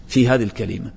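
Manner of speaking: ordinary delivery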